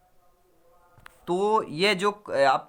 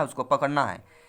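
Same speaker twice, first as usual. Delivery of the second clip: ordinary talk, nothing marked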